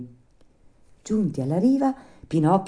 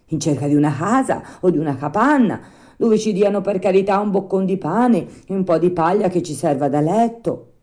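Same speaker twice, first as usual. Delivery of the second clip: a hint of puppet-boy, higher and louder; lightly voiced Pinocchio; 5 semitones higher, 5 dB louder than usual.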